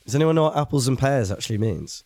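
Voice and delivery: ordinary talk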